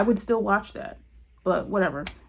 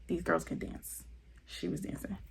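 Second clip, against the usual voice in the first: hushed admiration; 14 dB quieter than usual. Real, not acted. Vlogger impressed by the girls' dancing, hushed emphasis. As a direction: drop to an almost voiceless murmur of approval.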